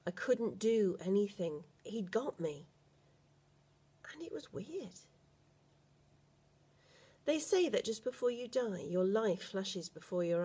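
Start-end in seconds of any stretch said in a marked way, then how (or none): 4.1-5.0 whisper. A narrator's reading. Narrator's eerie moment, whispered.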